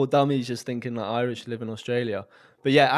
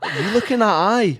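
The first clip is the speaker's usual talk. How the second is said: loud, higher Glaswegian challenge; mock Glasgow accent; 5 semitones higher, 4 dB louder than usual. Answering his mate's challenge, loud mock Glasgow accent. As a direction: square up in put-on Glaswegian. Mate squares up in mock Scottish.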